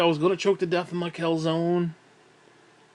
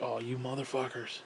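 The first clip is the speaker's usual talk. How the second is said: muttered curse; 3 semitones lower, 11 dB quieter than usual. Retold or imitated. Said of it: "and I'm like".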